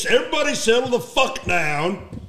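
forceful telling-off, acted out; commanding. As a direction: order a panicked crew to calm down. Retold order to panicking men.

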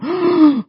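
high, sharp mock gasp; group gasp. Storyteller imitating the kids' reaction to the hit, mock gasp. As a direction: gasp like a whole shocked crowd. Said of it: "or a gasp, everyone".

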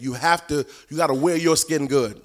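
usual speaking voice

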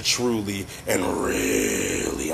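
"really" stretched out long, pitch low; slow, low "truly and", then a long "really"; drawn-out emphasis. Real, not acted. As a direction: draw "really" right out for emphasis.

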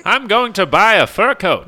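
loud, high mock villainess; Cruella de Vil. Joking that the villain is Cruella, loud high impression. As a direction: proclaim it as a hammed-up grande villainess. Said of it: "He's Cruella de Vil".

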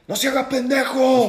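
angry yell. Retold or imitated. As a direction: yell at him to quit playing dumb. Customer yelling at man in line.

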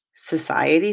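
plain reading voice

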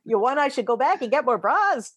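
mild mock Yiddish-inflected question. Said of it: "my dad doesn't talk like that".

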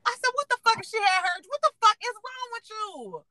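high, disbelieving what the fuck; retold shocked scolding of partner; incredulous scolding. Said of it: "I was like".

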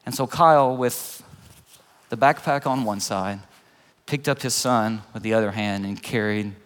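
ordinary delivery

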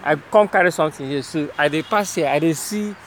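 friendly, fast Naija Pidgin; Nigerian Pidgin. Real, not acted. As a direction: soothe him in Pidgin. Reassuring worker in Pidgin.

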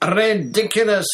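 high, very loud bellowed spell. A narrator's reading. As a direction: bellow it out with force. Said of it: "bellowed Ron".

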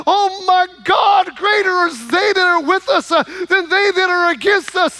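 triumphant cry. Retold or imitated. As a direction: cry it out with new courage. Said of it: "and he cries out".

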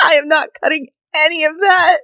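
talking through laughter. Real, not acted. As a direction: struggle to speak while cracking up. Still cracking up after joke.

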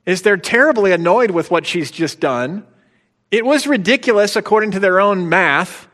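mocking. Real, not acted. Preacher mocks disciples' motive.

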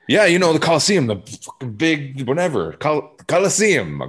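high exasperated tourist spelling it out; flustered tourist. Retold or imitated. Tourist begging a local for directions, high and pleading. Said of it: "I'm like".

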